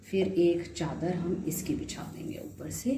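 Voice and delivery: hushed, breathy aside to the viewers; whisper. Real, not acted. Cook whispering the next step to viewers. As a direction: drop to a whisper as if sharing a secret.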